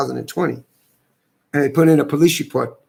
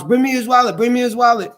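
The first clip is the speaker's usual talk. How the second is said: retold wife yelling; 8 semitones higher than usual. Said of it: "my wife is yelling down the street".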